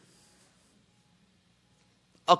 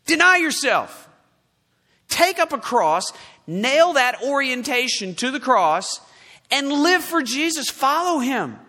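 raised, punched-out imperatives; forceful preaching command; 5 semitones higher, 4 dB louder than usual. Real, not acted. Preacher answering the congregation's excuses, forceful command. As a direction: hammer out each order, loud and high.

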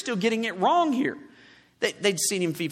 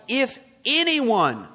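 "anyone" stretched out slowly and raised; stress on anyone; 4 semitones higher, slower than usual. Real, not acted. Preacher reading the verse to his congregation, stressing "anyone". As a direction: lean hard on "anyone", drawing it out. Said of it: "underline the word anyone".

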